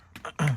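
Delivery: rough clearing of the throat. Real, not acted. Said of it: "Sorry about my throat today".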